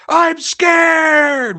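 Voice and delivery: high screamed "I'm scared!" in mock panic; mock scared shout. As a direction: shriek it like a terrified reviewer. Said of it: "with, like, a hundred exclamation marks".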